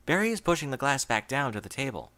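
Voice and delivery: ordinary delivery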